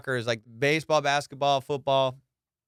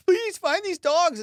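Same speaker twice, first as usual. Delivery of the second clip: high, slow mock plea; pleading plea; slower than usual. Mocking public pleading for lost dogs, high and slow. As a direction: mimic a desperate plea, pitched way up. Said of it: "everyone cared about him".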